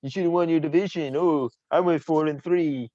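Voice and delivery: high, whiny mock complaint; needling, put-on high voice; mocking taunt. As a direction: jeer it in a high singsong voice. Mimicking a taunt about the division.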